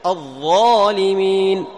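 slow tajweed demo; heavy ظ. Shown as a demonstration. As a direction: give الظالمين a full, heavy ظ. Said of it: "صعد الصوت إلى الحنك الأعلى".

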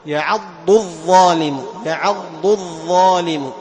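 drawn-out, emphatic يعض الظالم; distinct dad and dha. Shown as a demonstration. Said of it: "لاحظ".

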